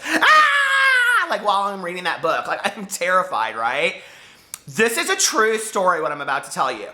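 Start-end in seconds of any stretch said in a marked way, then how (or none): 0.1-1.3 a high, loud mock shriek with a laugh; mock scare scream. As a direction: jump out and scream at the reader, half laughing. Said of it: "being like this".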